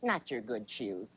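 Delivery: low, soft, drawn-out mom verdict; slowly disapprove, dragging the words; mom talk. Retold or imitated. Mom looking at your shoes, slow low disapproval. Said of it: "they'll look at you and go".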